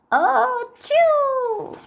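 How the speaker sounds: high, sudden oh-chew sneeze; startled sneeze in high voice. Acted. Doctor Cat sneezes.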